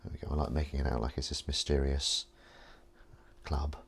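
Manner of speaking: ordinary delivery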